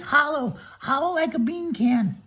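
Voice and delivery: high, relieved Tin Man; storyteller voicing a high grateful Tin Man. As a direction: pitch up as the freed Tin Man. Tin Man without a heart.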